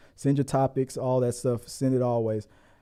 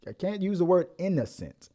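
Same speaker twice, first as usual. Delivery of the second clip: ordinary talk, nothing marked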